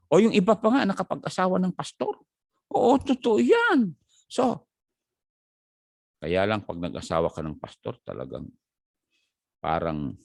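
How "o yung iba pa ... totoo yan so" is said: high, loud preacher's fervour; fired-up preaching. Acted. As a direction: rouse the congregation. Preaches at full force.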